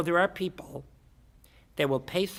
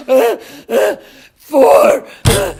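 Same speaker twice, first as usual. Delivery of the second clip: loud, high strained panting; struggling, winded; 9 semitones higher, 17 dB louder, much slower than usual. Acted. Guy doing jumping jacks, acted gasping struggle. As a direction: force the count out between gasps. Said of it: "he's having a real hard time".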